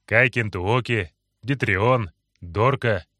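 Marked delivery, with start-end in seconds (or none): none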